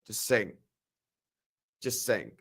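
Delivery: soft, low murmured tag; hushed aside. Shown as a demonstration. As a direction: trail it off under your breath. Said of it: "I will whisper it".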